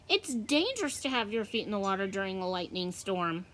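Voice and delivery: plain reading voice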